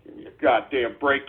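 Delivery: soft low grumble before it builds; muttered exasperation. Acted. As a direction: grumble it under your breath, worn out. Escalating repeated exasperation.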